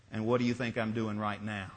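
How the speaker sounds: smiling fisherman. Acted. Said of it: "the fisherman was still smiling".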